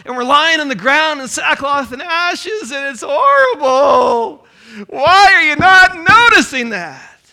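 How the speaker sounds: loud, high, whining complaint; whiny voice. Acted. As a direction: whine it like a spoiled child. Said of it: "you gotta have the whiny voice in there".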